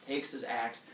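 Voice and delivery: normal talking voice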